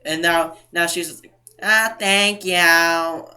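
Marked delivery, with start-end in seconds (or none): 1.6-3.3 very drawn-out, slow mock thank-you; fake thanks. Cashier covering her butt to the customer, fake thanks. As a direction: stretch the words with obvious insincerity. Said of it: "Like, it was fake".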